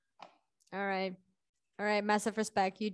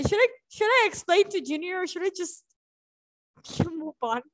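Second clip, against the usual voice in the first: usual speaking voice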